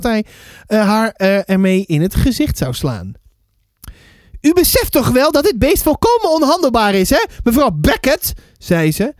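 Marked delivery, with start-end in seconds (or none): 4.4-8.3 high-pitched, affronted neighbour voice; indignant neighbour. Acted. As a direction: complain in a high, haughty voice. (verontwaardigde buurvrouw, hoge stem)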